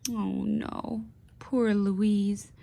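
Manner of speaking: ordinary talk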